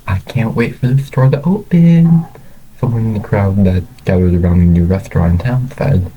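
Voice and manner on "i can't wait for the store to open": high, loud, eager exclamation; excited. A narrator's reading. Someone in the crowd waiting for the store to open, excited. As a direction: burst out with eager anticipation.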